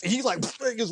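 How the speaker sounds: ordinary talk, nothing marked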